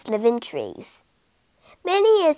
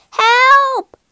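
higher, louder scream; cry for help; 13 semitones higher, 9 dB louder than usual. A narrator's reading. Rat fleeing the cat, scream for help. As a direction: shriek for help.

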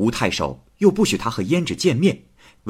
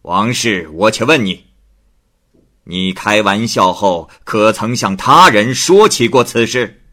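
louder, firm interrogation; stern magistrate; 3 semitones higher, 7 dB louder than usual. Acted. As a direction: press her for the truth. 太守审问王氏. (太守追问王氏)

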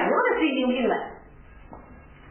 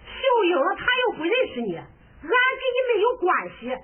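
much higher, shouting with a tearful edge; angry scolding; 7 semitones higher than usual. Acted. Mother shouts at the stranger to leave, angry tearful yell. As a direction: chase the madman off, furious.

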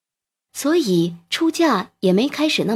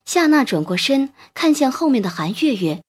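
plain reading voice

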